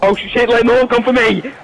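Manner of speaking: pressured yelling to teammates; urgent shout. Real, not acted. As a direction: call out from a tight corner. Stranded player calls team off.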